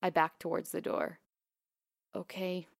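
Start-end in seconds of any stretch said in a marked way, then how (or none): 2.1-2.7 very soft whispered okay; barely there okay. A narrator's reading. The vet assistant quietly leaving the grieving owners, whispered. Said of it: "I whispered".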